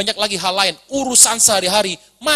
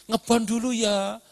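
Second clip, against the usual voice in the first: mock pleading; 7 dB quieter, slower than usual. Employee begging boss for advance.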